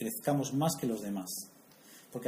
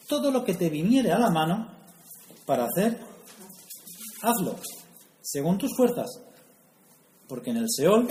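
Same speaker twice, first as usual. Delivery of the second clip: higher, louder, solemn reading of the verse; scripture reading; 4 semitones higher, 5 dB louder than usual. Real, not acted. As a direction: proclaim the verse like a preacher. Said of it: "Salomón nos quiere decir". (lectura solemne, más aguda y fuerte)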